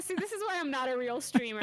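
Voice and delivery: usual speaking voice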